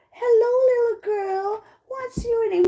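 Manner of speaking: high-pitched storybook wolf greeting; wolf's greeting. Acted. Old wolf meets a little girl in the forest, high greeting.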